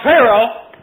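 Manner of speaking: high, loud call across the river; crying out. Acted. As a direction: call out to Pharaoh on the bank. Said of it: "Moses cries out".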